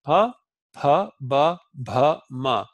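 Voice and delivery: pa, ba, ma modelled clearly; labial drill. Shown as a demonstration. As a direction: show each labial syllable to the class. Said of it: "you have the labials".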